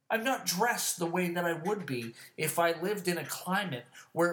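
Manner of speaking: high-pitched, incredulous venting; yelling rant. Real, not acted. Venting about below-freezing weather, yelling rant. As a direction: yell it out, exasperated at their stupidity. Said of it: "I'm just fucking yelling about it".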